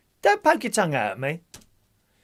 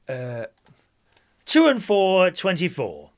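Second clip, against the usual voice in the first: loud, sing-song number calling; bingo caller; 5 semitones higher, 5 dB louder than usual. Acted. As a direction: call the numbers to the hall. Calls bingo numbers to players.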